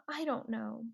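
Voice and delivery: whispered, almost gone. Acted. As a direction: breathe the words out. Boy answers brother, exhausted.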